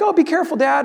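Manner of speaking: high-pitched imitation of a child's warning; worried daughter. Daughter warning dad at the fence, imitated worry. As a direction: lift the voice like an anxious kid.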